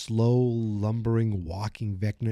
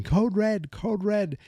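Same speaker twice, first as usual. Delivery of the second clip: high-pitched urgent radio alarm call; Dustin; 10 semitones higher, slower than usual. Retold or imitated. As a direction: imitate an urgent alert, voice pitched way up. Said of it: "you can hear Dustin saying".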